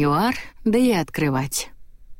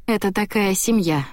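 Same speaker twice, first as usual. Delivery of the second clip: usual speaking voice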